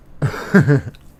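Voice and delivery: a loud, high laugh bursting out; losing it completely over the joke. Real, not acted. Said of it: "I don't know why I'm laughing".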